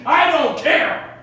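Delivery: loud, high, slow outburst; shout. Acted. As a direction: bellow it. Howard yells.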